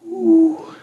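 high, hooted bird call; sustained mock dove cry; turtledove coo. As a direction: imitate a dove with one long high "ooh". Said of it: "You can hear the voice of the turtle".

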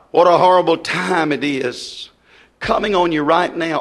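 ordinary delivery

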